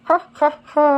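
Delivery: high, staccato "ha ha ha"; exaggerated high-pitched laugh; rhyme laugh. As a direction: laugh out the rhyme's ending in separate bursts. Rhyme's laughing ending, acted out.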